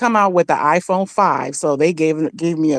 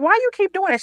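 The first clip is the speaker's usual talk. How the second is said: high, bothered retort; irritated high question; 13 semitones higher, faster than usual. Retold or imitated. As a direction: voice goes up, annoyed. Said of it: "And I was like".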